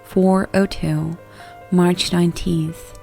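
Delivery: usual speaking voice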